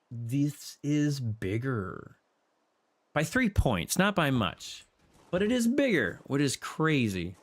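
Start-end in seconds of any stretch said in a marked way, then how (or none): 0.1-2.2 very slow, drawn-out words; slow realization. Real, not acted. 5.3-6.2 high, surprised emphasis; amazed. Real, not acted.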